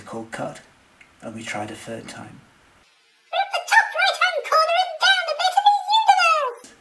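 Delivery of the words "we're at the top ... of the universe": extremely high, squeaky voice; excited high-pitched eep; eeping nurse. A narrator's reading. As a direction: squeal it loudly like a tiny creature. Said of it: "eeped the nurse".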